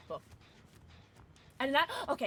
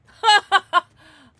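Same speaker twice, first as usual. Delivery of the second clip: loud burst of laughter; 6 semitones higher, 15 dB louder than usual. Real, not acted. Laughs at funny moment.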